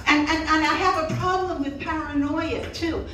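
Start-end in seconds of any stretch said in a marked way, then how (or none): none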